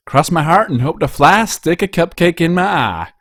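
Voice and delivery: cheerful country mare swearing; sing-song oath. Acted. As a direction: rattle off the oath with gusto. Applejack swears solemn promise.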